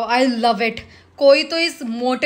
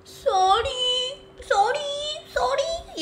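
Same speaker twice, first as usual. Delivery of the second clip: much higher, whiny drawn-out mock sorry; mock apologizing; 11 semitones higher, 3 dB quieter, much slower than usual. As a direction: mimic his cheap apologies, whining. Mocking contestant's endless sorry.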